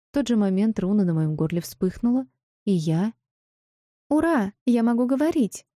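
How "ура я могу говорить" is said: squeaky, higher joyful cry. A narrator's reading. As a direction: squeak it out happily. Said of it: "противным писклявым голоском".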